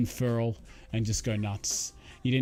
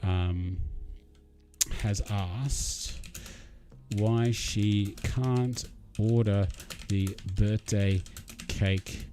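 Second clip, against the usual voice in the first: slow, drawn-out words while typing a message; slow dictation; 3 semitones lower, much slower than usual. Real, not acted. Streamer dictating a text to his contact, slow and drawn out. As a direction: spell it out slowly as you type.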